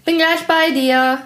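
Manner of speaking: put-on high boy's call across the room. A narrator's reading. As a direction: call over brightly in a high voice. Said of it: "rief in Juans Richtung".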